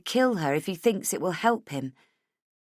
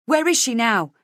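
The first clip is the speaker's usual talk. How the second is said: urgent; 6 semitones higher, 7 dB louder than usual. A narrator's reading. Frantic mother on phone.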